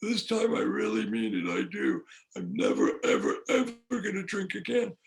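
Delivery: a hint of a pleading grovel; sniveling apology. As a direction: beg forgiveness in a mild mock snivel. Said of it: "I snivel back".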